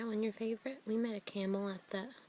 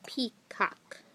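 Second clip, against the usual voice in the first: ordinary talk, nothing marked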